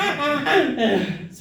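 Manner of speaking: loud laughing donkey voice. Retold or imitated. Donkey laughing at the pub crowd, loud and high. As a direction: cackle through the words like a hysterical donkey. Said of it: "And he's like".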